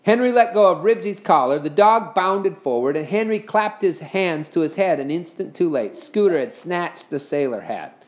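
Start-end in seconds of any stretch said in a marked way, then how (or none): none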